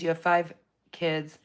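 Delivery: ordinary talk, nothing marked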